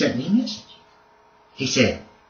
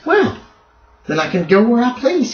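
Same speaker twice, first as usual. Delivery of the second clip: taunting outlaw; 9 semitones higher than usual. Acted. GM voices Sutter.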